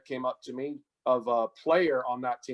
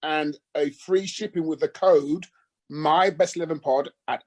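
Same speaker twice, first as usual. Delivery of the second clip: upbeat sponsor read, a bit louder; 5 dB louder than usual. Acted. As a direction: pitch the promo code like a radio ad. Sponsor ad read at show start.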